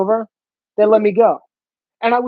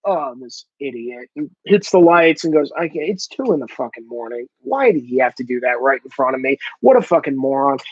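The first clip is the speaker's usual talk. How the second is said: mock annoyed cop; 6 semitones lower than usual. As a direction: mutter like a fed-up cop at 2am. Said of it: "do you think he went".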